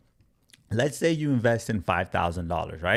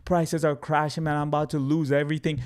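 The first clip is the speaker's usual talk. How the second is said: lightly voiced, higher regretful investor; a touch of mock despair, pitched up; mock dismayed buyer; 6 semitones higher than usual. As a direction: act out a buyer's mild dismay at a bad entry. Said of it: "You're like".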